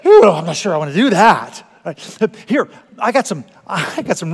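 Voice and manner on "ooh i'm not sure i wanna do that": mock reluctant wife. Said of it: "It's like".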